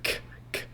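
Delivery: k k clipped and almost silent; voiceless k. Shown as a demonstration. Teacher showing the k position, soft voiceless k. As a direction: tap out soft k sounds. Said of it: "You get the k".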